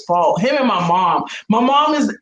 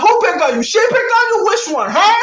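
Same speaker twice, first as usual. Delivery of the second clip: high, louder heated questions; fired up, ready to go find the kid; protective mom; 11 semitones higher, 4 dB louder than usual. Retold or imitated. Mom demanding which kid bullied her daughter, high and loud. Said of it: "I'm like".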